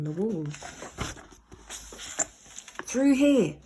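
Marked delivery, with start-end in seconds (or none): 2.8-3.6 louder urgent call. Acted. Ned calling Slime through window, loud. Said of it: "he called out".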